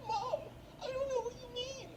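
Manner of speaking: very high, squeaky protest to mom; whiny denial. Acted. Cornered son denies it to his mom, high-pitched whiny protest. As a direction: plead innocence in a high falsetto.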